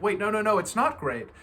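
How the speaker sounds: protesting friend. Retold or imitated. A friend objecting that a cold shower is not great, mock protest. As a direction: object loudly that it isn't great. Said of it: "somebody's like".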